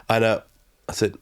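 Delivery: normal talking voice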